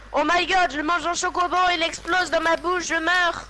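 high, loud fake-shocked YouTuber; mock shocked thumbnail. Parodying a clickbait thumbnail, hammed-up shock. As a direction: overplay the clickbait horror. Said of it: "Tu mets ça en miniature".

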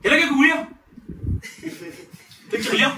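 high, loud mock Chilean; Chilean accent. A turn imitating Chileans, high loud Chilean accent. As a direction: pitch it way up as a caricature Chilean. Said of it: "eh, chileno".

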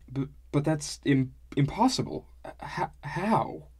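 flustered stutter, pitch jumping up; stammering. A narrator's reading. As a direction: stumble over the words in disbelief. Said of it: "I stammered".

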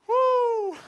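high excited whoop; joyful whoop. Real, not acted. Preacher whooping to the congregation in delight.

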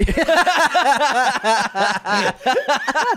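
high burst of laughter; loud laugh. Real, not acted. Big laugh at retold scene.

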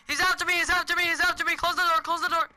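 high, loud, frantic gabble; panicked. Real, not acted. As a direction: cry out as if being chased. Chased by Jack in game.

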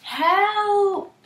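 high loud cry of alarm; cry for help. Acted. Animals calling from the flood, high panicked cry. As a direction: call out in panic. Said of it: "the animals cried".

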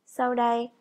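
normal talking voice

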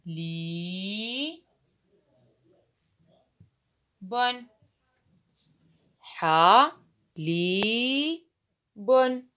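the word sounded out in slow separate syllables; teacher sounding out a word. Shown as a demonstration. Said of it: "الكلمة الأولى سوف أكتبها".

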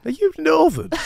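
high, loud, hammed-up Northern query; mock surprised Max. Retelling Max quizzing Diane, high mock disbelief. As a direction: squeal it in fake shock. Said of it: "And then Max goes".

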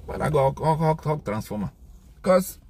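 normal talking voice

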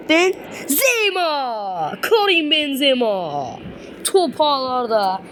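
slow drawn-out high goal call; screaming football commentator. Acted. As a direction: stretch the name like a TV commentator. Scores goal, commentator style call.

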